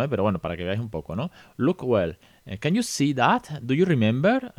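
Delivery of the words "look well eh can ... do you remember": higher, coaxing teacher questions in English; mock teacher prompt. Teacher quizzing a child again, higher and coaxing. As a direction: lead the pupil back with rhetorical questions. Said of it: "Este tipo de preguntas retóricas".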